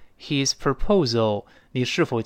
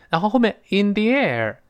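normal talking voice

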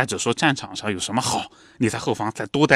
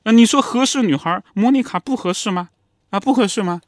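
mother pressing; 10 semitones higher, 3 dB louder than usual. Retold or imitated. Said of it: "妈妈就说".

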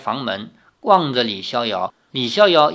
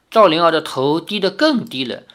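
usual speaking voice

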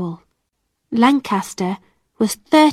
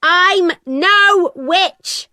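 high, very slow desperate shout; high drawn-out shout of denial; 8 semitones higher, 3 dB louder, much slower than usual. Acted. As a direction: cry it out, much higher. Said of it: "my mother shouted".

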